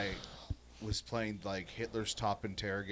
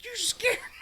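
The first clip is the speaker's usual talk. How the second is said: a far higher, squeaky startled cry; mock scared squeal; 4 dB louder, slower than usual. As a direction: squeak it like a spooked kid. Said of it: "And I was like".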